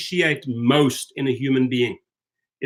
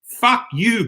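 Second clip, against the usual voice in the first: angry curse; 6 semitones higher than usual. Real, not acted. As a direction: spit it at him. Angry rant at a critic.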